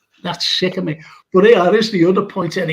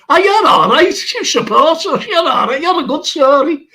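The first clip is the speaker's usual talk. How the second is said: much higher and louder, gushing approval; excited cheer; 9 semitones higher, 5 dB louder than usual. Retold or imitated. Fan welcomes the Tory in the pub, loud and gushing. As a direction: belt out the fan's delighted welcome.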